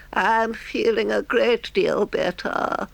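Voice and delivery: high, slow feigned feeble washerwoman. A narrator's reading. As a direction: play the poor weak patient in a high voice. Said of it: "said Toad in a feeble voice".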